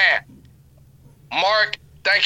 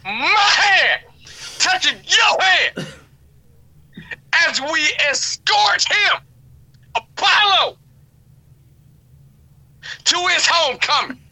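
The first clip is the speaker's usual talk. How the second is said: higher, hammy revival preaching; 5 semitones higher than usual. Pretend. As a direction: preach it to the congregation. Mock sermon continues.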